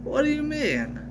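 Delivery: high, indignant squeak; high-pitched offended question; indignant what. Acted. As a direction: protest in mock outrage. Repeated indignant protest.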